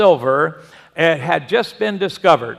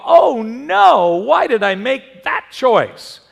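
mock dismay; 4 semitones higher, 4 dB louder, slower than usual. Voicing regret after choices go wrong.

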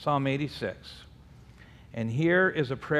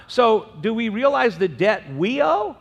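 ordinary delivery

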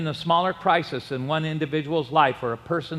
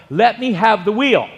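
loud raised demand; urgent demand; 4 semitones higher, 5 dB louder than usual. Retold or imitated. Son-in-law demands the wheel from Jerry, loud and urgent. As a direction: insist hard over the storm.

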